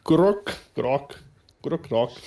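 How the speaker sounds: trying out Grok in British vowels; light British accent on Grok. Shown as a demonstration. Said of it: "pronounce it with a British accent".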